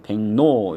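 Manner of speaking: 백로 said as 뱅노; nasalized pronunciation. Shown as a demonstration. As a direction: pronounce 백로 as "baeng-no", leaning on the second syllable. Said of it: "이렇게 발음하는 것이 맞다".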